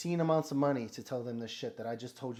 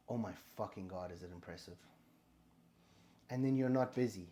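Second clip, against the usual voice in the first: ordinary talk, nothing marked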